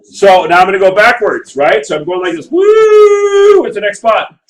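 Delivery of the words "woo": high, loud whoop of delight; cheer. Real, not acted. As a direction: celebrate finishing the graph with a whoop. Teacher cheers while drawing graph.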